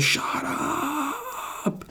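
a squeaky, badly done Arnold; Schwarzenegger impression. Quoting Schwarzenegger in Kindergarten Cop, hammed-up impression. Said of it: "like Schwarzenegger in, uh, Kindergarten Cop".

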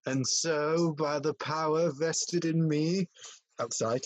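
priest voice. Acted. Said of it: "reads the priest's lines".

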